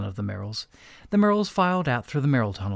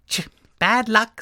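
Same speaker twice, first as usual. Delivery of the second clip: villain character voice; 10 semitones higher, 6 dB louder, slower than usual. Acted. Mister Merrill character voice.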